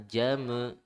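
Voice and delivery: ordinary talk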